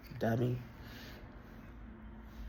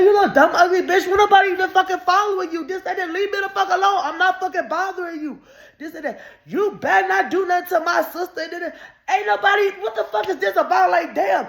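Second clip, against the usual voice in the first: high, loud yelling with laughter; hammed-up furious tirade; retold screaming rant; 6 dB louder than usual. Cursing out the girl leaving the escalator, shouting.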